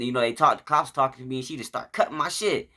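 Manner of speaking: ordinary talk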